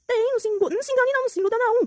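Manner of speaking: high-pitched mocking warning; sarcastic warning. Real, not acted. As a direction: wag a finger at them, singsong.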